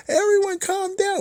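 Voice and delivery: very high put-on voice; mock plea to crowd. Woman out there telling everyone to calm down, mocked very high. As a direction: squeak it out as a mock public plea. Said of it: "go out there and be like".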